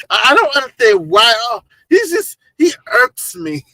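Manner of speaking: put-on high-pitched, loud Valley Girl griping; exasperated Ari impression. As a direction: mimic a thoroughly irked Valley Girl. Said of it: "That's Ari".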